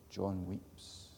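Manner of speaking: soft, subdued restatement; hushed echo. Acted. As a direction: let it fall quietly this time. Quiet echo of earlier cry.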